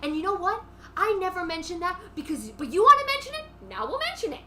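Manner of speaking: hammed-up Housewives shouting match; acted angry yelling. Acted quarrel, Ramona yelling back.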